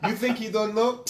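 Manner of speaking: put-on slow, high Russian accent, laughing; imitating Khabib with a laugh. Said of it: "I like your Russian accent".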